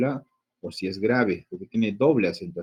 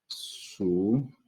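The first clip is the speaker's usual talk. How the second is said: "sutil" very slowly, su and til far apart; slow dictation of "sutil" while breaking it up; syllable by syllable; 3 dB quieter than usual. Shown as a demonstration. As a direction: spell out "sutil" in drawn, separate syllables. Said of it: "Vemos que hay dos sílabas tónicas".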